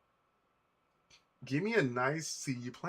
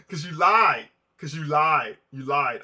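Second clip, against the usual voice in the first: louder mock scolding, fading each time; mock accusation; 8 dB louder, slower than usual. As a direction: blame the playlist, half-joking, louder. Scolding the playlist for lying.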